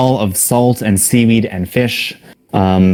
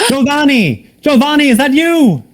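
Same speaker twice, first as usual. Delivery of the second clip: very high-pitched cry for help; distant, desperate high shout; calling from afar. Acted. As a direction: call out in panic across a long room. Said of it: "calling from the far end of the room".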